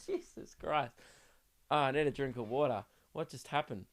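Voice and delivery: recovering from choking, voice barely there. Real, not acted.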